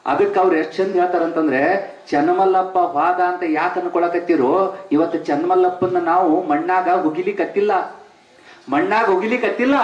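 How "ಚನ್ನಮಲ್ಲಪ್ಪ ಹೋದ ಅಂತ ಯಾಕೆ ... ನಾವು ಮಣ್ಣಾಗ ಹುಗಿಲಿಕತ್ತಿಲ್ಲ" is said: normal talking voice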